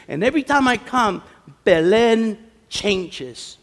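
high, loud, emphatic sermon voice; preaching. Real, not acted. Preacher urging the community in Belen, fervent preaching.

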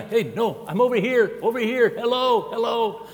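high calling out for notice; ignored ear calling. Retold or imitated. Ear calling to the body that stares at the eye, high. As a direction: wave for attention, high and insistent. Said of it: "It stares at the eye".

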